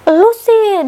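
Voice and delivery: high, loud mock scold from the aunt. Aunt blames her for the girl's crying, hammed-up scold. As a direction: snap out a blaming line as the angry aunt. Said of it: "Diomelin sama tantonya".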